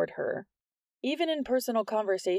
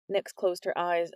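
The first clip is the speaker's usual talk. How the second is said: ordinary delivery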